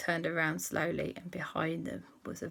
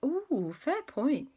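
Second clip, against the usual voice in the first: intrigued; 10 semitones higher, 6 dB louder than usual. Acted. Kids intrigued.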